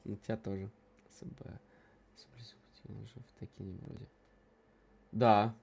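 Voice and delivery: ordinary delivery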